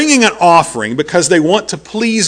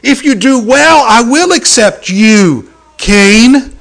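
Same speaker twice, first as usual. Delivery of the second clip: slow, louder, higher pleading; earnest appeal; 6 semitones higher, 5 dB louder, much slower than usual. Retold or imitated. God pleading with angry Cain, slow and earnest. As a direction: reach out to him slowly.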